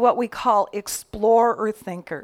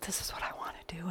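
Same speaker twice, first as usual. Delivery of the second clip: quick whisper; 2 semitones lower, 14 dB quieter, much faster than usual. Retold or imitated. Singer telling family her plan.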